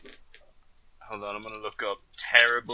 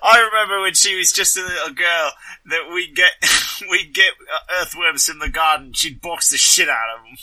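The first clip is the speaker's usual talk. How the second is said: reminiscing father; 5 semitones higher, 7 dB louder than usual. Acted. In character, father reminiscing about daughter.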